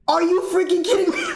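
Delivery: high, fast laughing outcry; finding the closet of gear, shocked and laughing; shocked laughing disbelief. Retold or imitated. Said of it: "I was like".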